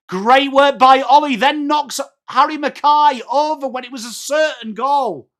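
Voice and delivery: high, loud play-by-play burst; excited call. Real, not acted.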